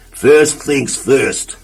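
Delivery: slow, drawn-out first things first; very slow, self-important; deliberate. Acted. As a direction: savour each word slowly. Mister Clumsy's slow pronouncement.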